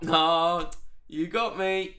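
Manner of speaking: exaggerated playful concession; mock defeat. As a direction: mimic an actor giving up too easily. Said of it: "Surely that's the way you meant to play that".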